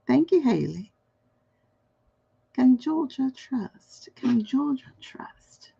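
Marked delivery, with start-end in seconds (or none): 4.1-5.7 slow breathy whisper, no voice. Shown as a demonstration. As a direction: hush it like a secret. Repeats question, then whispers it.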